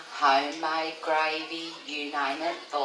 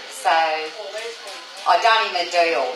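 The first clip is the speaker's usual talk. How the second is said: usual speaking voice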